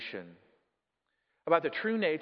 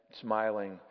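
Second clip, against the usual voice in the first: ordinary delivery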